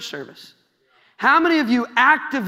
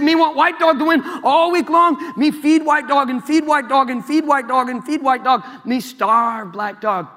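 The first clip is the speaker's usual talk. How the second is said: louder put-on Indian accent, slowing at the end; hammed-up Indian accent, gloating; comic Indian accent; mock Indian accent; 4 semitones higher, 4 dB louder than usual. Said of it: "I don't know if that's an Indian accent".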